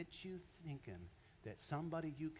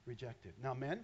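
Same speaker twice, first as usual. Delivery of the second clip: usual speaking voice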